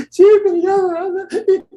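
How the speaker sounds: hammed-up crying girl; mock sobbing fan. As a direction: wail like a heartbroken teen. Fan crying over ticket.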